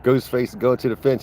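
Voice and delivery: ordinary talk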